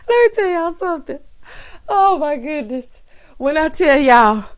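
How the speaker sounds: high voice left over from laughing; recovering from cackling. Real, not acted. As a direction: gasp it out after a laughing fit. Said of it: "that made me cackle".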